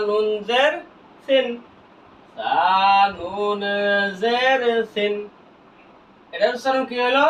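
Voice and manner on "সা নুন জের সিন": letter names held long; slow recitation drill for learners; drawn-out spelling. Shown as a demonstration. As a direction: stretch সা, নুন and জের evenly. Same spelling repeated drawn out.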